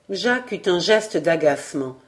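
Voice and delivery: ordinary delivery, nothing marked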